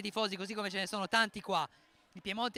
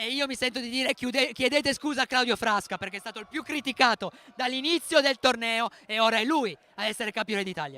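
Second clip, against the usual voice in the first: loud, raised, emphatic vindication; impassioned commentary; 4 semitones higher, 10 dB louder than usual. Real, not acted. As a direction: demand it loudly, carried by the win. Telecronista esaltato chiede scuse per Frasca. (telecronaca accesa, a voce alta)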